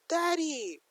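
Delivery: put-on a little girl calling out to her dad; happy child's cry. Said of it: "Eri just yells".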